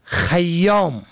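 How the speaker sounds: خیام said slowly as "khayyam"; فتحه on first letter. Shown as a demonstration. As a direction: draw out خیام with a after kh. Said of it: "به فتح اول". (خیام با فتحهٔ خ: خَیّام)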